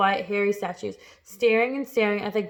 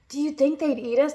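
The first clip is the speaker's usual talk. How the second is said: higher-pitched, worried Earthworm; timid, raised-pitch question; anxious question; 5 semitones higher than usual. A narrator's reading. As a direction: ask it with anxious dread. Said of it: "the Earthworm asked".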